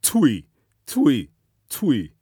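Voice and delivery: high, loud chuckling laugh; god's laugh. Acted. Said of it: "he laughed".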